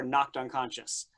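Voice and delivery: normal talking voice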